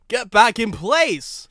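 snarled. A narrator's reading. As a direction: bite the words out at the engine. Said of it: "snarled Sam".

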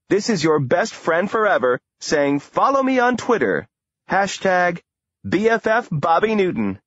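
high, bubbly celebrity-news voice; chirpy gossip host. Acted. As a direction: gush it like a gossip blogger. Gossip blogger's broadcast sign-off.

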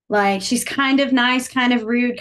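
loud, deliberate teaching example; emphatic demo. Shown as a demonstration. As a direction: lay out kind of nice, kind of rude slowly and firmly. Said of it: "demonstrating so-so".

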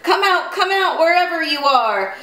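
high sing-song mock roar. A narrator's reading. Pigs imitate the wolf at their house, mock roar. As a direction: call it out high, roaring only lightly. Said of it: "he roared".

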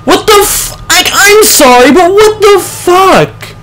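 high, incredulous outburst, drawn out at the end; can't believe what just happened; outraged disbelief. Real, not acted. Dies to a shot at legs.

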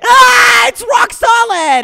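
very high, loud, drawn-out wail; agonised scream. Acted. Puncher shocked by his friend's hard abs, agonised scream. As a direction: wail it at the top of the voice. Hurt hand after the punch.

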